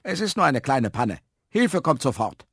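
a hint of an official intercom voice; lightly voiced caretaker. A narrator's reading.

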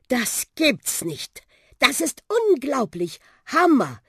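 high-pitched, drawn-out amazement; amazed exclaiming. Acted. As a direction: call out, thrilled by the flight. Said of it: "rief er". (begeistertes, staunendes Rufen)

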